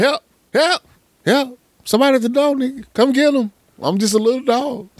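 very high squeaky panicked yelps; little dog voice. Retold or imitated. Owner voicing his panicking little dog, very high. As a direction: voice a tiny dog crying for help. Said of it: "They probably be screaming".

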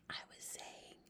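the tiniest breath of a whisper; barely audible whisper. A narrator's reading. Sophie repeats herself for the giant, barely audible whisper. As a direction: whisper so you barely hear yourself. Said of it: "She spoke so softly".

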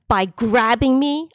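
high, slow, incredulous retort; livid. A narrator's reading. Woman answering his excuse, livid. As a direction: hit it hard with indignation. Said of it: "I was livid".

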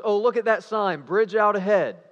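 loud, high teasing warning; mock alarmed announcement. Driver teasing the frightened chaperone, loud mock alarm. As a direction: call it out to scare a passenger.

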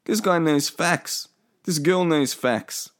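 high, slow mock admiration; imagined impressed listeners. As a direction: play an awed onlooker, drawn out. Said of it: "People will be like".

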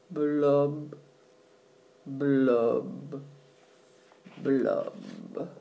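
low gloomy bubble blubs; slow sinking blub sounds. A narrator's reading. Fish bubbles after refrain.

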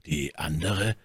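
very soft, subdued question; hushed, low echo of the words; muffled voice. A narrator's reading. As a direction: ask it so low it can barely be heard. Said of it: "mit so gedämpfter Stimme".

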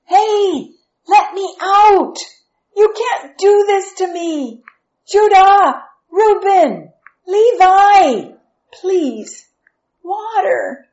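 high, loud cries for help; desperate shouting. A narrator's reading. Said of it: "Then he began to shout".

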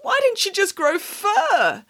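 slow, high-pitched whine of distress; wailing. A narrator's reading. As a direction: keep wailing, stretch the words out. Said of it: "wailed Boris".

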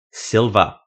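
loud urgent scream of her name. Acted. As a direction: yell to warn her in time. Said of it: "the thing in her head screamed".

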